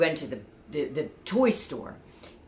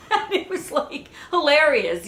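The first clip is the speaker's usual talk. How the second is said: laughing; 9 semitones higher, 5 dB louder than usual. Real, not acted. Laughing after the punchline.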